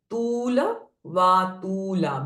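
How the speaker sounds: "तूल वा तूला" with ऊ and आ held long; long vowels. Shown as a demonstration. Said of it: "दीर्घ vowels". ("तूल वा तूला" carefully, lengthened oo and aa)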